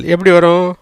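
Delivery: ordinary delivery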